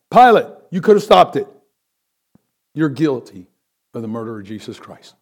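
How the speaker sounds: ordinary talk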